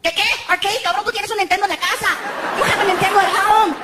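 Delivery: put-on high, fast Spanish-accented scolding; my Mexican mom. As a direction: scold like his own mother. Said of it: "flashback to twenty years ago".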